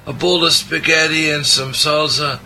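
high, hammed-up Italian accent through a laugh; sloppy Italian accent. Joking to his co-host and listeners, in a sloppy mock Italian accent. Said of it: "my kind of sloppy Italian accent".

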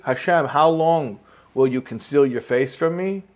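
"how long will you conceal your face from me?" put as an open question; "conceal your face from me?" left hanging as a question; read as a question. Shown as a demonstration. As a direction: ask "Hashem, how long" directly, awaiting an answer. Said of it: "That's the question".

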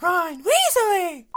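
high, slow, stretched call; mock calling out. Calling a character's name in jest, stretched high yell.

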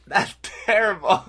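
high-pitched put-on British scolding; exaggerated Brit, appalled at the joke; mock British accent. Reacting to co-host's bad pun, mock British accent. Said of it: "you doing a British accent".

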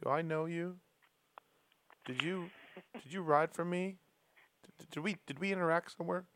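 a hint of a quiet, puzzled old boss; puzzled DeCoster. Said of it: "DeCoster was looking at him with".

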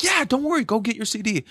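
high-pitched imitation of an easygoing reply; retold upbeat reassurance; reassuring singer. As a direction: mimic a friendly musician, voice way up.

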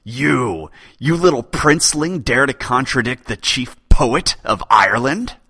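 loud, high outraged roar; furious poet scolding king; raging. Acted. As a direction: explode at the upstart prince. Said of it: "Dalan erupted in rage".

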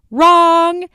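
loud, high, drawn-out "wrong"; sharp jump up in pitch and volume; emphatic rejection. Pretend. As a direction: call out the verdict like a game-show buzzer. Game-show style rejection of wrong answer.

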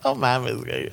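hushed mock reaction. Imitating reaction in video.

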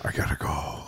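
low whispered excuse; furtive mock whisper. Caught prank caller to his ex, furtive whisper.

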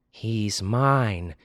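plain reading voice

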